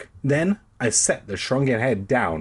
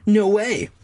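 teasing refusal; 10 semitones higher, 4 dB louder than usual. A narrator's reading. Sister teases brother.

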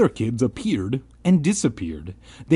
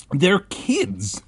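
excited, pitched-up exclamation; exclaimed; 8 semitones higher than usual. A narrator's reading. Ben spots other children in the fog, exclaimed. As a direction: exclaim it with surprise. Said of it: "Ben exclaimed".

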